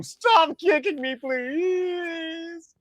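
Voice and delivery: high, wailing mock plea; imitated pleading scream. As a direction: drag out a high-pitched cry for mercy. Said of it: "the way he would scream".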